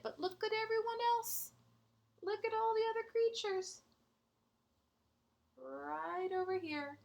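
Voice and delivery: high and quick, full of wonder; eager show-and-tell voice; excited pointing. Acted. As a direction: point it out with a rush of glee.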